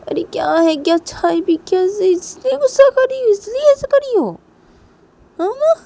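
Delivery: very high, loud indignant retort; acted exasperated outburst. A narrator's reading. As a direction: fire back in disbelief, pitch shooting up. Husband retorts incredulously in quarrel. (झुंझलाकर बहुत ऊँची आवाज़ में)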